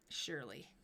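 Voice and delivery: very low, soft "Surely"; deep surely. Shown as a demonstration. Player repeating her own word to herself, very low and soft. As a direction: drop "Surely" way down low and quiet. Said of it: "surely your".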